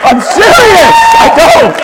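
high, loud, insistent cry of sincerity; earnest shout. Real, not acted. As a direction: shout it out, meaning every word. Preacher insisting he means it.

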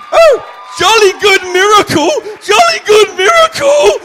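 loud, high mock British exclamation; hammed-up posh English praise; mock British cry; British accent. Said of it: "he wasn't British".

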